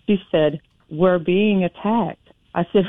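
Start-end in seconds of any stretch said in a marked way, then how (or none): none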